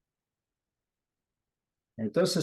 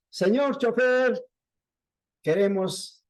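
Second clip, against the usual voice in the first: imitated calling out; 6 semitones higher than usual. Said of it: "empezaron a gritar al chofer".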